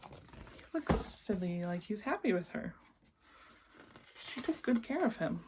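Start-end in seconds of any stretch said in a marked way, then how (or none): none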